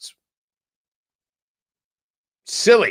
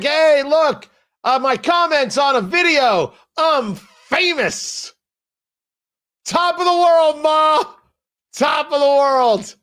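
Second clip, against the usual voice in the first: mocking a commenter; 8 semitones higher, slower than usual. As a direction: play a gleeful nobody shouting from the rooftop. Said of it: "He's like".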